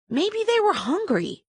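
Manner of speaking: high-pitched, pointed retort. A narrator's reading. Grace answers the girl who accused her, pointedly. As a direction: make the suggestion a high, pointed dig. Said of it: "said Grace pointedly".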